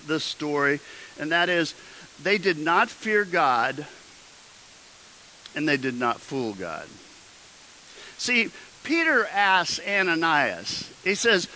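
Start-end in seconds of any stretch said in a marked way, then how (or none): none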